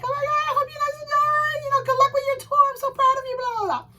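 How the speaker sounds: high excited gushing voice; gushing fan. Retold or imitated. Fan gushing to a singer at signing, high and excited.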